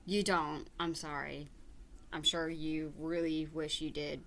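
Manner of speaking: ordinary talk, nothing marked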